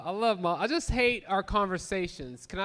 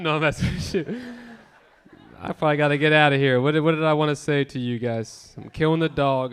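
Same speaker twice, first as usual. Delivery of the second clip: ordinary talk, nothing marked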